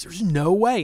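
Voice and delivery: mock astonished denial; disbelief. Nurse disputing patient's height claim, incredulous. Staff member disbelieving the measurement.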